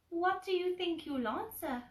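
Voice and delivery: high curious Piglet question; tiny piglet voice, pitched well up; small high piglet asking. Acted.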